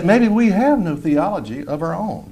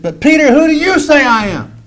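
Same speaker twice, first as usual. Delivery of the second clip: higher, louder earnest question; voice of Jesus; 11 semitones higher, 5 dB louder than usual. Retold or imitated. Jesus asking Peter, higher and louder, earnest. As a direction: ask it with gentle intensity. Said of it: "and he said".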